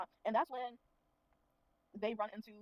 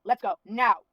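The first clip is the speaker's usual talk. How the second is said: curt command; 15 dB louder, much slower than usual. Retold or imitated. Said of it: "So Lu Feng is like".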